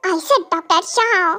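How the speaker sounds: ordinary delivery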